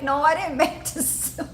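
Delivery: high voice giving way to giggles; laughing retold reply. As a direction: retell your own denial, cracking up. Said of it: "I'll be like".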